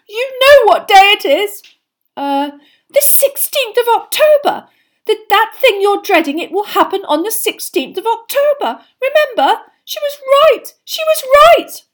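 very high, loud, distraught lament; tragic wailing. A narrator's reading. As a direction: wail as if doom came true. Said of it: "said Lavender tragically".

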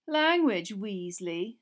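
mocking rebuke; Malfoy taunting Ron; sneering. A narrator's reading. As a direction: tut him with a sneer.

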